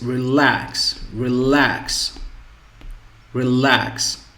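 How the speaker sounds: "relax" with a light first syllable and long, emphatic second; stress on second syllable. Shown as a demonstration. Teacher drilling the verb for learners, second syllable of "relax" stressed. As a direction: lean hard on the end of "relax". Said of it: "relax is on the second syllable".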